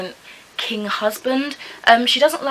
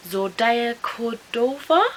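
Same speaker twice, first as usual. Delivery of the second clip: slow, tentative, rising at the end; hesitant attempt at a difficult name; unsure pronunciation; 4 semitones higher, slower than usual. Real, not acted. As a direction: sound out an unfamiliar name, questioningly. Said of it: "I don't know if that's how you pronounce it".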